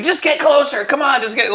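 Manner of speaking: high-pitched, insistent coaxing; pushy director. Retold or imitated. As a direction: imitate a director egging on a nervous actor. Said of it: "that's such a director thing to do".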